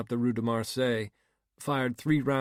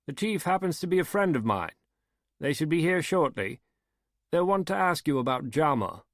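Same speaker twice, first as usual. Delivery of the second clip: a hint of a raised, genteel older man; slightly raised, courteous gentleman's voice; lightly voiced Harry; 5 semitones higher than usual. A narrator's reading. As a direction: lift the voice into a polite, assured host.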